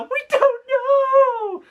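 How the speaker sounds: very high, drawn-out kiddie wail; terrified children. Retold or imitated. Five terrified munchkins answer the teacher, high frightened wail. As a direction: squeal it slowly as scared little munchkins. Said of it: "that's how they talked all the time".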